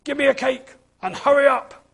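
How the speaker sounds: high, fast rude snap; snapped. A narrator's reading. Mister Grumpy orders Missus Flower about, snapping. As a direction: bark the order at the baker. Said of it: "he snapped".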